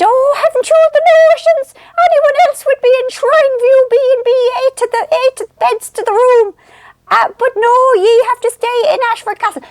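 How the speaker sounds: very high, shrieky mock relative voice; disapproving cousins. Cousins mocking her notions about the hotel, very high put-on scolding. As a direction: mimic scandalised relatives way up high. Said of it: "they kept saying it".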